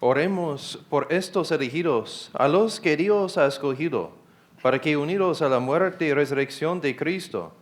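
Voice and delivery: normal talking voice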